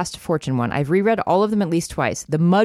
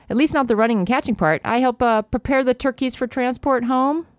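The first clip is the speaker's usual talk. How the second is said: raised, dry old lady, lightly voiced; Ida Bell's voice; 8 semitones higher than usual. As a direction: pitch it up and deliver it deadpan. Ida Bell voiced in read-aloud novel.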